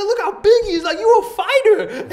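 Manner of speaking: very high, loud, cartoonish excitement; hammed-up fan squawk; imitating gawking stranger. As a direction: yell it like an awestruck onlooker, near laughing. Voicing a gawking overexcited stranger.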